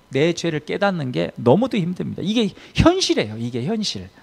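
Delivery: usual speaking voice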